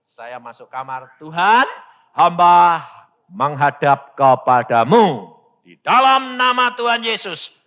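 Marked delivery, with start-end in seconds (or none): 1.2-7.5 loud, declaimed prayer; weighty acted invocation; praying like Hezekiah. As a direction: proclaim it solemnly before God. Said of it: "persis seperti Hizkia".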